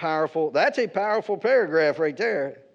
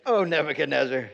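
mock dismissive; 3 semitones lower than usual. The three young men brushing off the king, lightly scornful. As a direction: say "Oh, Nebuchadnezzar," like addressing a doofus. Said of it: "This doofus".

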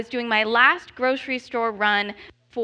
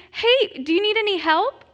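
bright, higher helpful worker's voice; helpful custodian; 8 semitones higher than usual. Retold or imitated. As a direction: chirp it like a friendly store worker. Custodian offers help.

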